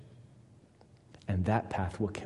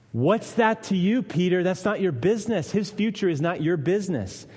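higher and louder, firm rebuke to Peter; firm Jesus rebuke; 7 semitones higher, 5 dB louder than usual. Acted. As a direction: set Peter straight, strong and firm. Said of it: "Jesus replies with some strong words".

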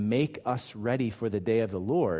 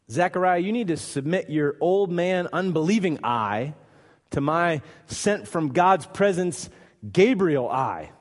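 loud, raised voice, weighty phrases; emphatic rebuke; 6 semitones higher, 5 dB louder than usual. Acted. Gabriel putting Zechariah in his place, emphatic rebuke. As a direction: come down firmly on the doubter, punching phrases.

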